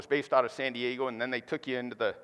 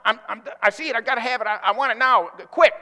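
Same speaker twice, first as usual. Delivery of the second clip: higher, louder, stammering greed; impulsive; 9 semitones higher, 9 dB louder, faster than usual. Acted. Pastor playing famished Esau grabbing for stew, impulsive. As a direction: tumble over the words like he can't wait. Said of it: "You can hear the impulsiveness in his voice".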